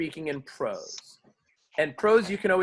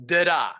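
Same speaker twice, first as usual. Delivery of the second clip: da-da with the second beat longer and stressed; da-da rhythm; 4 dB louder than usual. Shown as a demonstration. Teacher demonstrating da-da to the class. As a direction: tap out da-da like an eighth note to a quarter note. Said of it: "So that it goes".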